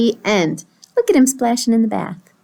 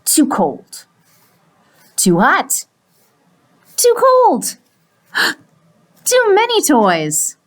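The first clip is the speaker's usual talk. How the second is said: exaggerated complaints, swinging high and loud; fussy pigeon; 5 semitones higher, 5 dB louder, slower than usual. Pigeon finding fault with every bath, fussy outbursts. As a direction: reject each bath with growing drama.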